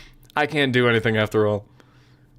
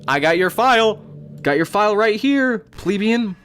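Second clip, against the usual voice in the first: gloating taunt; 9 semitones higher, 4 dB louder than usual. Pretend. Gloating at the deleted character.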